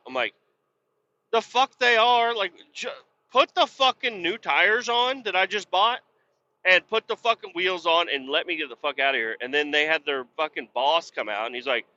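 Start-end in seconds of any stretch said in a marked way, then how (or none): 1.3-9.4 high, indignant, rushing at the end; retold angry retort. Telling the tire shop workers off, high and indignant. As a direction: replay the outrage at the mechanics, a bit hammed up.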